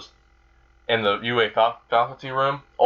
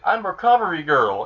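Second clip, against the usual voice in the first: lightly voiced old lady, pitched up; higher-pitched little introduction; Recovery Girl; 7 semitones higher than usual. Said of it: "the old lady's like".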